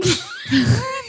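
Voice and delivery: real laugh squealing up high; high cracking-up laugh. Real, not acted. Tripped over the documentary's name, high cracking-up laugh.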